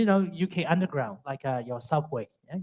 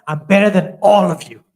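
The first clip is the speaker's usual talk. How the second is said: 1 inner boast; 4 semitones higher, 12 dB louder than usual. Retold or imitated. His inner boast about the band.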